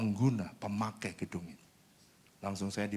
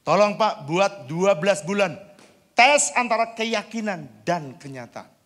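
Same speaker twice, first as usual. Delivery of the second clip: urgent plea; 11 semitones higher, 12 dB louder than usual. Retold or imitated. Pastor quotes an urgent plea to the boss, high and loud. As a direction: beg him to give it a year's test.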